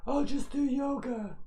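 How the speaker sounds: high, slow, sing-song therapist voice; chirpy know-it-all therapist; physical therapist voice. Retold or imitated. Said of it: "You like my physical therapist voice".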